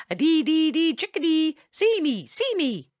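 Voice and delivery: high, chirpy bird song; merry chickadee call. A narrator's reading. Tommy Tit flies to the dooryard with a merry call. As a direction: sing it out merrily as you fly. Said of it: "kept saying this over and over".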